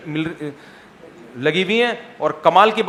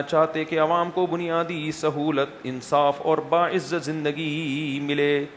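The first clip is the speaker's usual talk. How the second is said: reading a question aloud; 2 semitones lower, 4 dB quieter than usual. Acted. Mufti reads a viewer's question to the camera, measured read-out. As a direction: read the question out evenly, a bit quieter.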